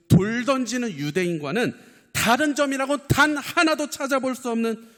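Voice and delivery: high, loud preaching cry; impassioned preaching. Real, not acted. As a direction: preach it at full pitch, pressing every phrase. Preacher at full force.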